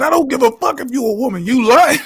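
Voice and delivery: high, loud indignant yell; shouting. Retold or imitated. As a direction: yell it out, calling her a liar. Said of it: "He said".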